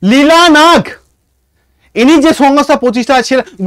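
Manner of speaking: ordinary talk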